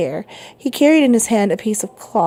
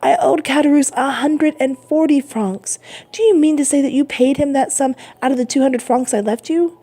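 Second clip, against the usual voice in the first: put-on raised, upset young man; crying out; 6 semitones higher than usual. A narrator's reading. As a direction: press him, alarmed. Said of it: "cried Dantès".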